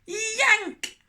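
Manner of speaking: high, loud yank; startling loud yank. Acted. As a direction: shout it like a jolt. Sound word in story.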